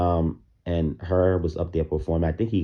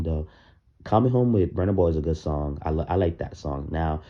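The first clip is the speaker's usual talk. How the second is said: ordinary talk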